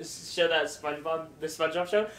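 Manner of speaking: ordinary talk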